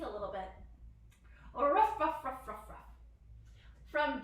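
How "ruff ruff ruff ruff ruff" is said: loud ruffs that fade; dog bark. Shown as a demonstration. Teacher as tail-wagging doggy, playful fading barks. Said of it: "Can you ruff like a doggy?".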